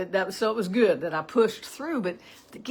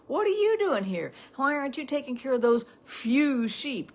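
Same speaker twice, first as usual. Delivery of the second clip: put-on high-pitched, belittling older brother; demeaning; 7 semitones higher, 3 dB louder than usual. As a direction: imitate a brother scolding the younger one, pitched well up. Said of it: "Trying to demean, trying to discourage".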